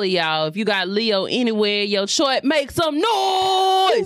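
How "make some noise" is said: slow drawn-out high shout; rally the Leos like a party host; hype shout. Real, not acted. Hype call to Leo listeners.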